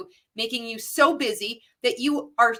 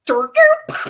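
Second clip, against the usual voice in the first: mock glitch noise; 10 dB louder than usual. Mimicking brain short-circuiting.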